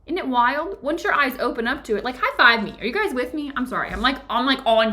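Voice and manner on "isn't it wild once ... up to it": normal talking voice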